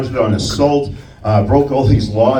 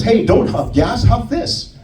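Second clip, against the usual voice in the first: ad slogan; 10 semitones higher, slower than usual. Retold or imitated. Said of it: "the catchline was".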